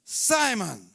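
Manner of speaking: loud calling of the name. Acted. Jesus calling Simon to get his attention, loud call. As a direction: call him like Jesus would.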